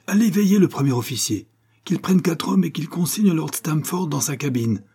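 high-pitched, commanding delivery; urgent orders. A narrator's reading. Captain ordering a suspect confined, urgent orders.

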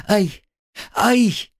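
sharp high yelps; cry of pain. A narrator's reading. Girl cries out in pain.

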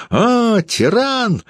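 very high squealed accusation; outraged high screech; woman's shriek. A narrator's reading. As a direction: squeal it at the top of your pitch. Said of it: "взвизгнула Юлия".

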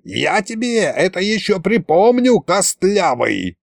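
high villain threat, slowing at the end; theatrical vengeful ghost, last word stretched; wailed threat. A narrator's reading. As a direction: wail revenge as you flee. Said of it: "завопил колдун".

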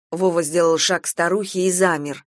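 ordinary delivery

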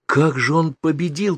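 high, slow exclamation of wonder; astonished boy's thought; amazed. A narrator's reading. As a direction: marvel at it, stretching the words. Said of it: "поразился я".

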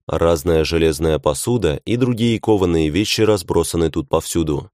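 ordinary delivery

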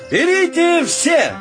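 high, ringing generous invitation; grand offer. Acted. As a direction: proclaim the giveaway to everyone. Soap maker grandly hands out gifts.

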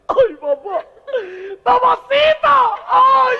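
high falsetto wailing cry; girl leaves the doctor crying, loud falsetto wail; mock crying girl. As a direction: wail like a hurt little girl.

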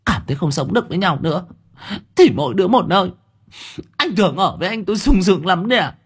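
high, fast scornful retort; defiant wife. A narrator's reading. Wife to husband after the slap, high scornful retort. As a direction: spit contempt back at him.

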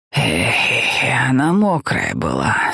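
put-on low, slow tired old man. A narrator's reading. Helmut voiced in the story.

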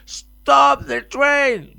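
high, loud, very slow shouted command. Retold or imitated. Theatre director left behind yelling at the train, drawn-out high shout. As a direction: yell it to halt the leaving train. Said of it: "ahí mismo grita".

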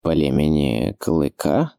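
slow, low puzzled question with a rise at the end; drawn-out, low-pitched astonishment; surprised. A narrator's reading. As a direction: echo it slowly in low-voiced surprise. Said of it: "удивился ключник".

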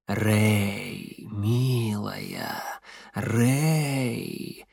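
low, ingratiating male voice; low fawning voice. Acted. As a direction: coax her sweetly, low and oily. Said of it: "Низкий, мужской, заискивающий".

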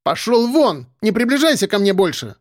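high-pitched capricious outburst; petulant. A narrator's reading. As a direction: order him away like a spoilt, offended woman. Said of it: "капризно добавила". (капризно, высоким голосом)

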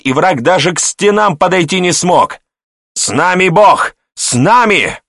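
high, loud rousing speech; rally the defenders like a fiery preacher; loud exclamation. A narrator's reading. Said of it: "громко воскликнул он". (громкая воодушевляющая речь)

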